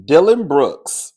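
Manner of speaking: slow, loud announcement; announcing. Real, not acted. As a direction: call the name out slowly. Announcing the topic.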